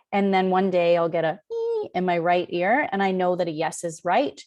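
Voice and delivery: usual speaking voice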